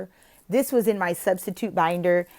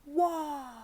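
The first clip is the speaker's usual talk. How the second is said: cheery prompt; 7 semitones higher than usual. Shown as a demonstration. Teacher prompting students to spell wow, bright. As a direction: call it out to the class. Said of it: "and then I say".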